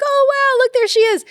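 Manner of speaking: high, loud squeal of imitated delight; excited parents. Parents spotting Chi-young on set, imitated excitement. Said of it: "they were genuinely excited".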